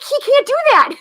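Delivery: high squealed amazement between giggles; re-enacted giddy, high-pitched disbelief; gleeful disbelief. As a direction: squeal it, astonished and laughing.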